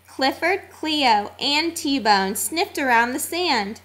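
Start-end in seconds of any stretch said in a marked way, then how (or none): none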